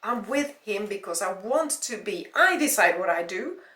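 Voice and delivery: loud, emphatic indignation; angry retort. Acted. Jenny defending her choices to her ex, angrily. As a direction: cut in forcefully and assert yourself.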